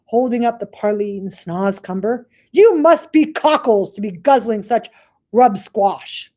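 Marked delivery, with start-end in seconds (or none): 2.5-6.3 loud, higher scornful giant; sneering giant. Acted. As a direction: jeer at the little giant. Bloodbottler mocks BFG.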